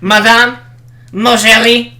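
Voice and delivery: high, loud mock French. Co-host mocking the French class, loud mock French. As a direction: put on a silly French accent. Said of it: "we say madam".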